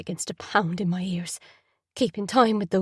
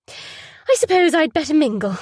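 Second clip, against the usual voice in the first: bright, louder put-on cheer; forced brightness; 7 semitones higher, 6 dB louder than usual. Mother putting on a brave face for the guests, forced cheer. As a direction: paste on a smile and sound cheerful. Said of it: "pasting a smile on her face".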